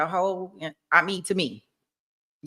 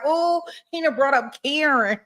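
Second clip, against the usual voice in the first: mock surprise; 9 semitones higher than usual. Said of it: "I was like".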